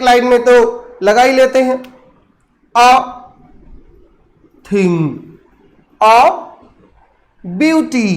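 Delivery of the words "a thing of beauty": "a thing of beauty" with a long pause after each word; syllable-by-syllable scansion. Shown as a demonstration. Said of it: "single syllable दिख रहा है कि double syllable". ("a thing of beauty" एक-एक शब्द पर रुककर)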